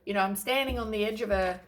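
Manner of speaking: ordinary delivery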